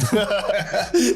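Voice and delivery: cracking up at his own story; loud laugh. Real, not acted. Guest laughing at his own joke, high burst of laughter.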